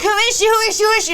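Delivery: high, loud, repeated retold cry; screaming. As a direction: reenact frantic screaming on repeat. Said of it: "I kept screaming".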